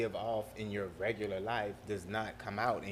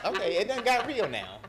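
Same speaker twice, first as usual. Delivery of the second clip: laughing announcement; 5 semitones higher, 7 dB louder than usual. Real, not acted. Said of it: "will be in Gullah".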